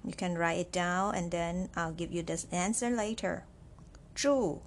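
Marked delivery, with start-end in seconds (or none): 4.1-4.6 住 repeated with a short falling fourth tone, higher; dictating Chinese word. Shown as a demonstration. Teacher repeating 住 for students, clear falling tone. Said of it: "what is the tone for 住".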